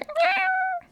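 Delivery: very high kitten meow. Pretend. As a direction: mew like a tiny kitten. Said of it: "is it better if I say".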